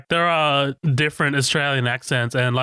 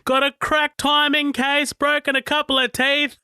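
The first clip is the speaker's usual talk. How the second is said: imitating the shouting mechanic; 11 semitones higher than usual. Podcast host imitating the mechanic shouting the rig report, high mock shout. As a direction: bellow it up high like the grizzled mechanic. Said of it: "I love this guy shouting back".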